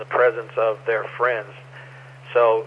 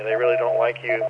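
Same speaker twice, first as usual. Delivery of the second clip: usual speaking voice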